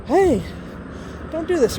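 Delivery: mild mock outburst. Mom finally speaking up, imitated mild outburst. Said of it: "not bad explode, just be like".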